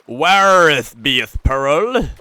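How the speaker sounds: slow, loud, drawn-out mock-archaic wail; dramatic archaic shout. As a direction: boom it out like a bad stage actor.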